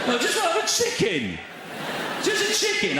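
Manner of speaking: high pleading squeal at the machine; begging. Acted. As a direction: beg the till in a high squeaky voice. Begging the self-checkout machine.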